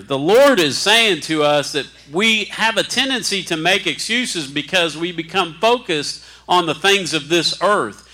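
usual speaking voice

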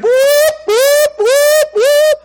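very high, loud repeated whoops; sharp high whoop calls; imitated crowd whoops. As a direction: mimic the crowd going off.

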